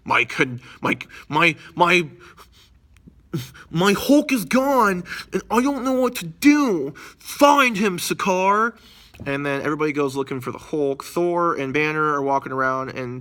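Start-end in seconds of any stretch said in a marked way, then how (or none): 0.0-8.8 much higher, slow, whiny panic; much higher, whiny despot voice; frantic Grandmaster. Retold or imitated. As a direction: wail like a spoiled ruler who lost his toy. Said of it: "Grandmaster's losing his shit".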